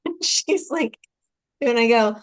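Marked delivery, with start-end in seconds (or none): none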